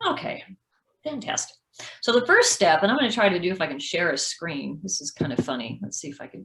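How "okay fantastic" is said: sarcastic. Retold or imitated. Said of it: "I'm like".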